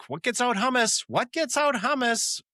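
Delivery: hammed-up panic about the stain; panicked Ross. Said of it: "he's like".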